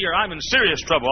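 ordinary talk, nothing marked

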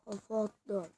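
low, soft muttered repeat; quiet. Real, not acted. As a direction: say it under your breath. Same line said to himself.

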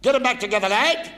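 high, loud drill sergeant bark; barking chief petty officer. Retold or imitated. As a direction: bellow it like a feared naval chief. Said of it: "He said".

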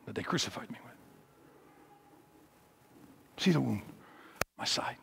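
low, soft, with long pauses between phrases; hushed, solemn invitation. Acted. Risen Jesus speaks to doubting disciple, hushed and solemn. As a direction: let each phrase fall quietly, then wait.

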